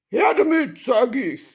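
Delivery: loud, high robber's demand; gruff shout. A narrator's reading. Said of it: "eine barsche Stimme rief".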